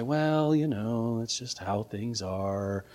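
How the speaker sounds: low, soft, trailing dismissal; resigned shrug. Acted. Preacher mimicking people who let error go, resigned shrug. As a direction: let it sink into passive acceptance.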